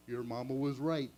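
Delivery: lightly voiced Yogi; Yogi Bear voice. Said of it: "in cartoon voices".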